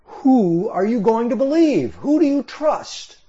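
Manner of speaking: high, mocking challenge from the enemy envoy; cynical taunt. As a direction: sneer the question at the king, pitched well up. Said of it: "a very long and cynical speech".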